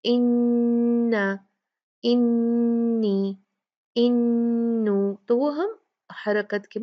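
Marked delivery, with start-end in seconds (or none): 0.0-5.2 इन्ना held slowly with a long nasal hum; nasal ghunna demo. Shown as a demonstration. Teacher demonstrates ghunna to students. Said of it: "जैसे".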